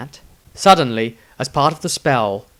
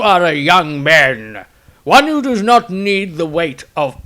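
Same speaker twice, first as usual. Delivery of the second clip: put-on higher, louder, slow solemn old voice; dying master's letter; 4 semitones higher, 7 dB louder than usual. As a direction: read it as a mentor's farewell. Magnamus's final written message.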